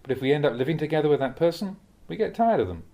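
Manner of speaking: ordinary talk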